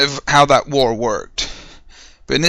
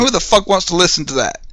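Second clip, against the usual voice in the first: ordinary delivery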